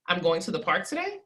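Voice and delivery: today? lifted in disbelief; surprised question ending up on today; rising question. Shown as a demonstration. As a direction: ask it, pitch climbing on today. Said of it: "I'm gonna emphasize it three different times".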